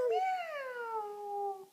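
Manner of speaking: high, drawn-out kitty meow; cat meow. Pretend. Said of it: "That was a loud kitty".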